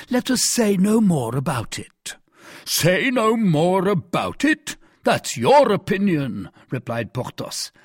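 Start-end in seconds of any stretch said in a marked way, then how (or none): 0.1-2.2 higher-pitched, falling close to the subject; brisk dismissal, ending on a fall; dismissive statement. Acted. 2.7-6.5 slow, high indignant question; drawn-out affronted retort; incredulous echo. Acted. Porthos indignantly echoes Aramis.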